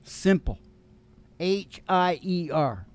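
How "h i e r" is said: normal talking voice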